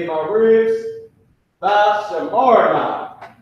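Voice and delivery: "tha shamarna" drawn out slowly and loud; slow Hebrew phrase. Shown as a demonstration. Teacher modelling the Hebrew phrase for students, slow and loud. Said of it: "This is what you would say".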